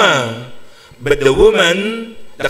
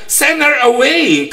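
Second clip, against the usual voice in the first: disciples' demand; 8 semitones higher, slower than usual. Acted. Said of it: "Sabi niya".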